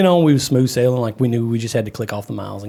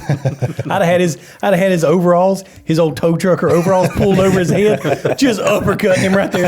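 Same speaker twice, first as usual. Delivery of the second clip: laughing, louder and higher brag; 5 semitones higher, 3 dB louder than usual. Real, not acted. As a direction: boast through laughter about the fight. Joking about fighting the driver.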